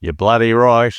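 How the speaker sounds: quick jokey Aussie twang on bloody right; a playful Australian accent, tossed off; mock Australian accent. Podcast host answers cohost's Aussie accent tease in mock Australian accent. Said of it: "our Australian accents next week".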